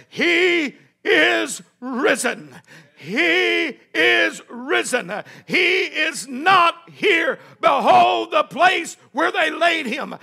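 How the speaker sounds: very slow, stretched-out preacher's declaration; triumphant proclamation. Acted. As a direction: ring out each word, drawing it long.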